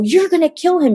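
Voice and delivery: high, alarmed shout; terrified high-pitched yell; panicked yell. Retold or imitated. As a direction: cry out to stop the pastor. Yelling at pastor during exorcism.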